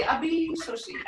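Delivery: put-on a touch of Abisushi. cooed over a baby; imitating mom adoring a baby; mom cooing. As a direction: lightly voice mom doting on a chubby baby. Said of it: "And she'd say".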